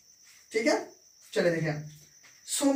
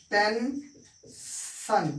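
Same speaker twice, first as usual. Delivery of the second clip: Tension stretched very slowly, each syllable held; slow drawn-out demo; 4 dB quieter, much slower than usual. Shown as a demonstration. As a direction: spell out tension slowly for the class, ten-shan. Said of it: "एक example हम देखते हैं".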